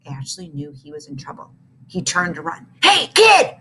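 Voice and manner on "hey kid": loud, high call; bully calling after a fleeing kid. A narrator's reading. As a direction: shout to stop him running. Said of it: "called Tarantula".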